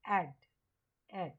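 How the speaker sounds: ऐड with a short open "a"; short A demo. Shown as a demonstration. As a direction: say ऐड briefly, mouth open, tongue relaxed. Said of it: "फिर से आप बोल के देखिए". (ऐड में छोटा ऐ, मुंह खोलकर)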